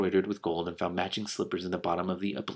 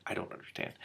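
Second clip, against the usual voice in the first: whispering; 13 dB quieter than usual. Real, not acted. Confused aside.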